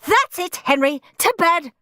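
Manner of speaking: high, firm parental shout. A narrator's reading. Mum sending Henry to bed, shouting. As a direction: shout it as a final order. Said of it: "shouted Mum".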